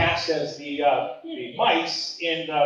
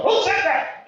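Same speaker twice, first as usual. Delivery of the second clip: high-pitched mock teacher outcry; sudden high, indignant call across the room; teacher's sharp demand; 12 semitones higher, slower than usual. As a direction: imitate a teacher catching someone swearing. Retold teacher catches him swearing.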